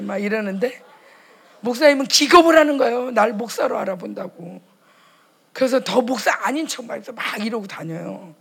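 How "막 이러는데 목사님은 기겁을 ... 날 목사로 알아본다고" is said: normal talking voice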